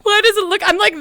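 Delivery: high, loud, whiny near-tears; half-laughing, pretending she'll cry; about to cry. Real, not acted. Streamer embarrassed by her avatar, whining near tears. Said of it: "I'm gonna cry".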